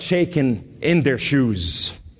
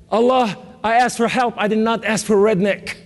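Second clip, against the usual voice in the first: mock complaint; 7 semitones higher than usual. Retold complaint to Allah, comic.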